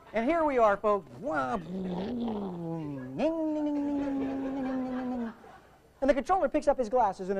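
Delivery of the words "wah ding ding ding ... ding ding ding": one wah, then a long ringing whine; imitated engine revving. Engine blasting away for the folks, whining. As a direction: mimic an engine dipping, then whining high.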